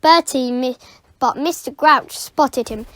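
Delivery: ordinary delivery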